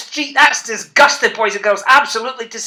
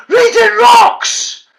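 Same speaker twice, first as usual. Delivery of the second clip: full-throated shout, much higher and louder; 12 semitones higher, 7 dB louder, much slower than usual. Shown as a demonstration. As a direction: belt it out for the kids to copy. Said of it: "shout as loud as you can".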